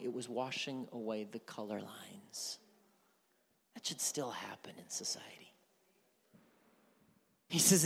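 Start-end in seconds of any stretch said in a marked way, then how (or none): 0.0-5.3 hushed, breathy aside, almost secret; whispered aside. Real, not acted.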